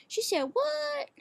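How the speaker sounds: "She said what?" as a shocked question, pitch sweeping far up on "what?"; upward inflection. Shown as a demonstration. Vlogger demonstrating a question's upward inflection, very high and slow. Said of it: "it's, like, an upward inflection".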